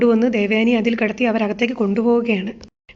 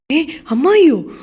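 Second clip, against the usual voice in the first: higher, louder, slow shocked ask; surprised; 5 semitones higher, 5 dB louder, slower than usual. Retold or imitated. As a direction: caught off guard by the news. Son hears shocking news.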